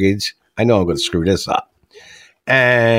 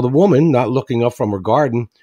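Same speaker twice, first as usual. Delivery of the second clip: normal talking voice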